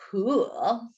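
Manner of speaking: ordinary delivery, nothing marked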